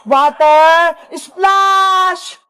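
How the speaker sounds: very drawn-out power-move shout; attack call. Acted. Iska attacking Wednesday, drawn-out attack call. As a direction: stretch both words into an attack cry.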